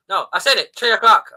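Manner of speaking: imitation Irish, "three" said as "tree"; Irish accent. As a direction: insist in a put-on Irish accent, punching the last words. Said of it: "three and T seem to".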